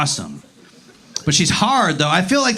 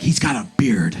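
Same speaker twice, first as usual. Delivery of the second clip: deadpan low voice; 10 semitones lower than usual. Retold or imitated. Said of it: "She's like".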